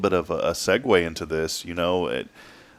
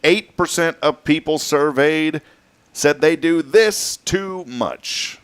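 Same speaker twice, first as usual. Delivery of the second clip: slow, higher, dramatic game-show read; quiz host tease; 7 semitones higher, 4 dB louder, slower than usual. Acted. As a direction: build suspense over the question. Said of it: "Our impossible question".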